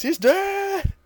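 high, taunting sneer; gloating villain. Retold or imitated. Cell taunting Goku about his son, high mocking gloat.

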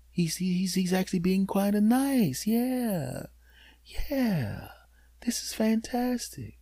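a lightly voiced sleepy cat whispering; cat's thoughts. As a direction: voice the cat, blissed out and drifting off. Cat's inner voice, contented.

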